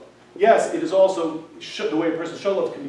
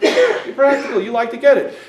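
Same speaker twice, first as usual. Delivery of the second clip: higher, louder wife voice; lightly voiced wife making her point; wife's point; 6 semitones higher, 7 dB louder than usual. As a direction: press the point home as the wife. Wife voiced in retold story.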